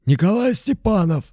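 hoarse, high name announced. A narrator's reading. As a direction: rasp out the full name. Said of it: "хрипловатым голосом".

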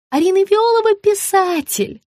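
high insistent gush; high sugary woman. A narrator's reading. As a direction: repeat it sweetly. Margarita repeats.

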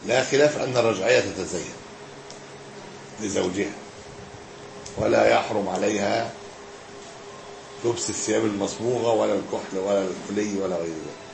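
measured scholarly reading with long pauses; formal text reading. Acted. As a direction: read it out phrase by phrase, letting endings drop. Reads the book aloud.

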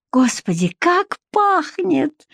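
rapturous moan, rising high and slow; swooning over the smell. A narrator's reading. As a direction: stretch it into a moan of delight. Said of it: "простонала Вика".

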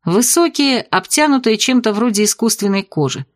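plain reading voice

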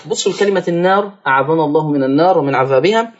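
usual speaking voice

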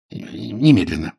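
slow, choked, urgent word; strangled urgency, drawn out. A narrator's reading. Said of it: "каким-то сдавленным голосом".

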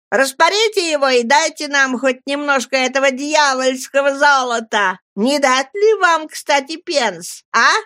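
put-on high, teasing kids' voices; mock the miser like street boys; taunting boys. A narrator's reading. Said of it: "вопили они".

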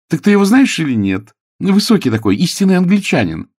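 inquisitive lady. A narrator's reading. Lady asking Clara about the stranger, inquisitive and high. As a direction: press a friend with lively questions.

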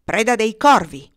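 loud, bold shout back; defiant call. A narrator's reading. Boy calls back to the dove, defiant call. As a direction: announce it at full voice.

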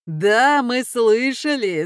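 jeering laughter. A narrator's reading. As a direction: voice the brothers loudly mocking. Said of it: "засмеялись братья". (насмешливо, со смешком)

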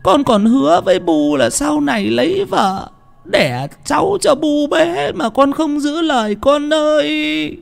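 high, slow wailing grief; old mother keening high and slow; wailing lament. Acted. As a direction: wail for the dead son, drawn out. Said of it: "bà cụ Hí khóc òa lên".

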